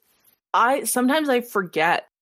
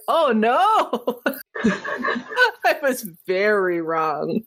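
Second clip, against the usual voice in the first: laughing; much slower than usual. Retold or imitated. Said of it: "I'll be like".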